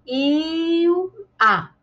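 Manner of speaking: "E o A" as a long high "iiil", then a separate "a"; wrongly split digraph. Shown as a demonstration. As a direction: break ilha apart, stretching the first syllable. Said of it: "Não, não tá certo".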